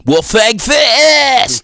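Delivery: high, drawn-out battle shout; hammed-up fighting-game shout; anime attack call. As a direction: yell the move, stretching the last word. Imitates Yamcha's attack call.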